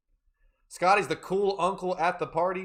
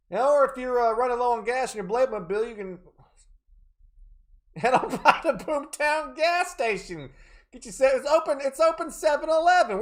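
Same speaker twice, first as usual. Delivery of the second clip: high, loud radio-ad pitch voice; cheesy ad announcer; 8 semitones higher, 5 dB louder than usual. Pretend. Streamer plugging a fake Boomtown spot, hammed-up announcer. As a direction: sell it like a local TV commercial.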